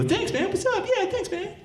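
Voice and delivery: very high, flattered chirp; eager thanks. Acted. Flattered reply to the men.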